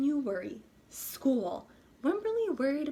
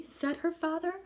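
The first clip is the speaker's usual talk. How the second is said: plain reading voice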